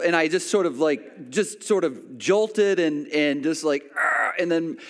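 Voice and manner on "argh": high strained "argh" of pain; imitated yelp from a sting; mock pained groan. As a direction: act out a jolt of sudden pain. Jolting from the jellyfish sting.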